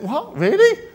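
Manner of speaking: sudden squeaky "What? Really?" with a laugh; mock disbelief. To the congregation, mimicking a believer's shocked disbelief. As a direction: act stunned and laughing at the idea.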